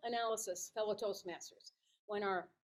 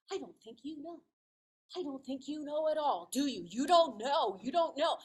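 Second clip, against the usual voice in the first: imitating a child's rising accusation; pestering kid catching out a parent; badgering child; 4 semitones higher, 4 dB louder than usual. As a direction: build the needling, getting louder. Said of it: "He kept badgering me and badgering me".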